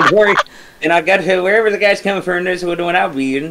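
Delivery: high put-on southern twang; lightly Cajun-tinged southern accent. Shown as a demonstration. Trying out the character's accent for friends, southern accent. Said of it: "the kind of southern accent".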